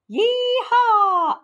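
high, loud yee-haw; yelled cowboy whoop. A narrator's reading. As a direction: whoop like riding a bronco. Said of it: "I yelled".

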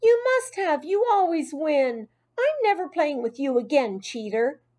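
put-on high, heated child's outburst; high-pitched quarrelling shout; angry shout. A narrator's reading. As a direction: hurl accusations at your friend. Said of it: "Gail shouted".

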